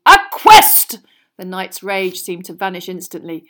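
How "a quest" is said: loud, high thrilled cry; excited knight. A narrator's reading. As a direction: burst out with delight. Knight's rage turns to delight.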